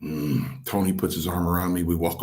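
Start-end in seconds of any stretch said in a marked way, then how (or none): 0.0-0.6 low imitated grumble; an irritated, wordless "mm"; Strongbow. Mentor hears the rookie refuse, imitated irritated grunt. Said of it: "when Strongbow was a little irritated".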